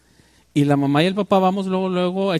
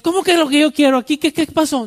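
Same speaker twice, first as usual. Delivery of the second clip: higher, louder mock confrontation; indignant challenge; 9 semitones higher, 4 dB louder than usual. Tough guy confronts the speaker, a hammed-up indignant challenge. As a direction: bristle up, acting the tough guy who arrived. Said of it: "y llega el ente".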